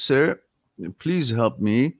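ordinary delivery

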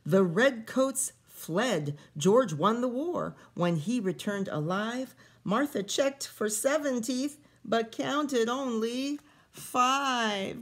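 lively read-aloud storytelling voice; story reading. A narrator's reading. Teacher reading a picture book to kids, lively storytelling. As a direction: read the rhyming story to the children.

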